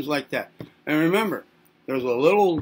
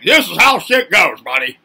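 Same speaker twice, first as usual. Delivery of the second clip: tough-love parent; 4 semitones higher, 9 dB louder than usual. Retold or imitated. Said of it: "You're like".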